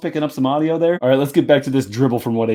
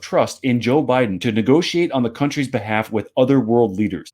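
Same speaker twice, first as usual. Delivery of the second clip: usual speaking voice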